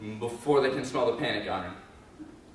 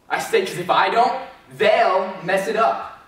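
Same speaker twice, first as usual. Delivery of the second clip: defiant outburst; 6 semitones higher, 8 dB louder than usual. Acted.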